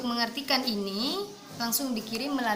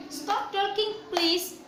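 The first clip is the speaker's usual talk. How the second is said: firm teacher command; 7 semitones higher, much slower than usual. Shown as a demonstration. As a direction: ask the class to stop talking. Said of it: "kemudian misalkan".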